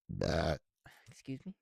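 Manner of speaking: ordinary talk, nothing marked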